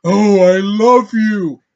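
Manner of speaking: very loud, drawn-out mock love cry; hammed-up lover. Reviewer mocking a forced movie scene, loud hammed-up declaration. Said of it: "Kylo Ren looking motherfucker doing this".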